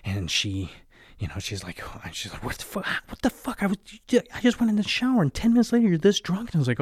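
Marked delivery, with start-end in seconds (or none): 2.4-6.5 high, hushed then loud shrill outburst; imitating his wife catching him drunk; mock shocked wife. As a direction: play the wife losing it, high and fast. Wife's shocked outburst retold.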